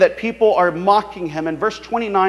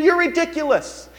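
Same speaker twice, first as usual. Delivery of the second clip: high sneering jab; mocking; 9 semitones higher than usual. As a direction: toss it off with scorn. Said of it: "they're saying".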